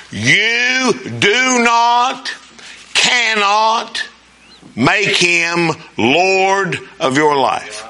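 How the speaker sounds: words drawn out, very slow; slow emphatic preaching. Real, not acted. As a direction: lean hard on "do not" and "cannot". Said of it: "listen carefully".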